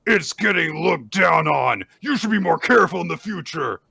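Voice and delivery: loud, high, forceful shout; hammed-up bully's scolding; acted angry warning. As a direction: bellow a threat at a rival. Acted furious speech continues.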